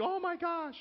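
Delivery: imitating his wife seeing the bloody leg; shocked gasp. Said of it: "and she".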